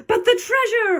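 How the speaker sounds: high, dismayed captain's cry; pirate holler. A narrator's reading. Captain protests turning back, hollering. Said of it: "hollered Braidbeard".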